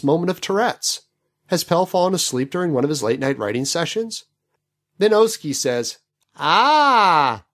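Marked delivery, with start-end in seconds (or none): 6.4-7.4 short loud mock grunt, full of meaning; meaningful grunt. Oski grunts meaningfully to the crew, mock loud grunt. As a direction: grunt as if it says everything. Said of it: "a monosyllabic grunt vibrant with meaning".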